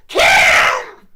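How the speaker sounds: piercingly high, full-volume mock scream. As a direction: belt out the lover's name on demand. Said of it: "Say it louder".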